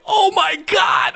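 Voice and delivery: high slow exclaimed shock; losing it. Retold or imitated. Viewer reacting to the shocking dish, high stunned shout. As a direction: freak out in mock disbelief. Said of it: "I was losing it".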